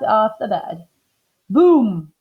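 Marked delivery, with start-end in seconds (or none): 1.5-2.1 loud, punchy boom; sudden boom. A narrator's reading. Floor gives way under the bed, loud boom. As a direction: hit it like a crash. Sound effect in read-aloud story.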